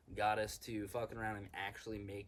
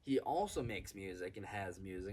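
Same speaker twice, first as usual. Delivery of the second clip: ordinary talk, nothing marked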